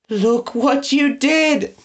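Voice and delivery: drawn out through sobs; sobbing accusation. A narrator's reading. Prisoner blames Eggs, sobbing slowly. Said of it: "sobbed bitterly".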